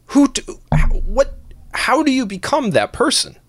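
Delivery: high-pitched disbelief at strangers; incredulous. Real, not acted. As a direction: squeak out the question in disbelief.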